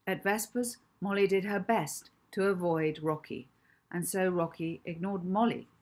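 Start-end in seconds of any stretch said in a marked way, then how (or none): none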